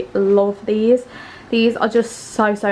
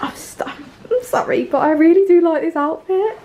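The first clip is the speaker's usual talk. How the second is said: higher, louder gushing; excited; 8 semitones higher, 4 dB louder than usual. Real, not acted. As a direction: burst out with delight. Gushing loudly about outfit.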